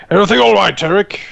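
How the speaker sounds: captain shouting across to the dwarf. Acted. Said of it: "basically shouting".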